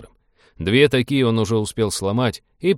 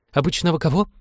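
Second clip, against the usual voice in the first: high-pitched alarmed question; startled, fearful character voice; frightened; 8 semitones higher than usual. A narrator's reading. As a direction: ask back in a nervous high voice. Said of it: "испуганно переспросил Фрэнк".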